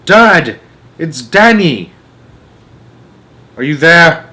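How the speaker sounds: loud, high shouts into the woods; lost boy calling for his father. A narrator's reading. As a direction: yell for Dad, searching. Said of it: "walking and calling out".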